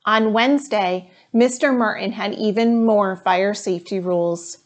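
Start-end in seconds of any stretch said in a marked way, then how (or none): none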